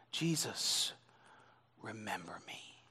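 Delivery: soft, humble whisper; whispered plea. Acted. Preacher contrasting the humble thief's whisper. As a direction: breathe it like a dying man. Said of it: "he simply said".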